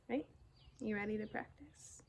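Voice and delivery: soft playful whisper. Real, not acted. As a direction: whisper it like a secret. Playful whispered aside to Otto.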